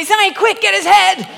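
urgent shout. Retold or imitated. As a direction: yell to Zion mid-struggle. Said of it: "I say".

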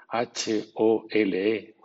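each letter spelled slowly and apart; spelling out letters. Shown as a demonstration. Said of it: "por ejemplo". (deletreo lento)

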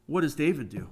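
normal talking voice

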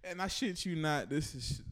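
ordinary talk

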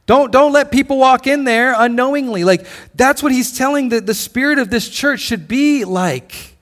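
ordinary talk, nothing marked

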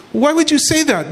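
high-pitched mock-baffled question; puzzled king. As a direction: act out a king taken aback. King retelling, questioning his servant.

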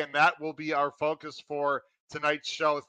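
usual speaking voice

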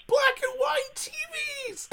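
very high incredulous outburst; high-pitched, astonished exclamation; incredulous squeal. Real, not acted. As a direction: squeal it in comic disbelief. Incredulous repeat of his phrase.

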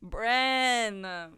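sharp indignant call; loud exclamation. Real, not acted. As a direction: shout the name in outrage. Calling out a friend's name.

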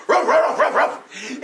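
bright yappy barks; playful dog barks; dog barking. Acted. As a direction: bark like a hungry dog. Dog barking opens the fable.

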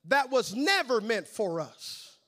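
usual speaking voice

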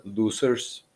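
lightly put-on German accent. Imagined Germans jeering at the podcast, mock German accent. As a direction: say it as a German would. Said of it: "However they say it in, like, a German".

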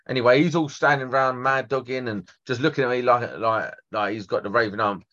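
normal talking voice

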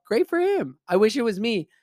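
very high, loud exclamation; emphatic approval. Real, not acted. Storyteller cheers the man who got the wife, emphatic.

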